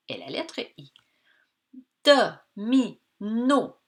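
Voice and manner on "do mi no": "Do, mi, no" one syllable at a time; clearly spaced syllables, "Do", "mi", "no"; separated syllables. Shown as a demonstration. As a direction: break "Do, mi, no" into three distinct beats. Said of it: "Dans la syllabe no".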